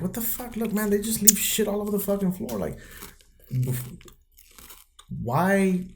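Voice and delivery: mild mock outrage at a messy floor; exasperated rant. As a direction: throw up your hands at the mess, half-mocking. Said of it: "I was like".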